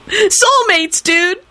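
high lament ending in drawn-out howl; wail, then stretch the last words into a howl; wail to keening howl. A narrator's reading. Kenny mourns his muggle friend, keening. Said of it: "finished with a keening howl".

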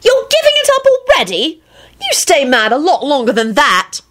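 very high, indignant squeal; acted outraged girlish shriek; shrieked protest. A narrator's reading. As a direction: complain in a shrieking pitch. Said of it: "Rosie shrieked".

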